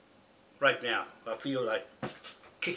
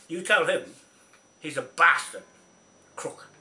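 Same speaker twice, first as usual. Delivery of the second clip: louder, furious name-calling; angry shout; 3 semitones higher, 7 dB louder than usual. Real, not acted. Ranting at Kingy about his lawyer, angry shout. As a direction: bark the insult.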